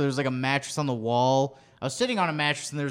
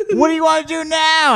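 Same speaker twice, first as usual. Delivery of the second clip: high, loud imitated question; mock friend voice; 7 dB louder than usual. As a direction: imitate a friend asking, pitched way up. Voicing his friend's eager question.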